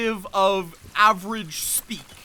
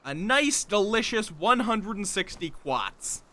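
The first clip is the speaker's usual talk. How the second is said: put-on slow, savoured Boston-accent quartz; slower than usual. Gloating over mined quartz, slow mock Boston accent. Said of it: "saying quartz in, like, a Bostonian accent".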